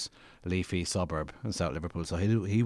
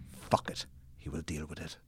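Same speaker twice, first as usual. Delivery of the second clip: whispered, much softer, to himself; the character brushing off his worry in a whisper; whispered aside; 2 semitones lower, 7 dB quieter than usual. Acted. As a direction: mutter it under your breath, dismissing it. Character's inner voice in reading.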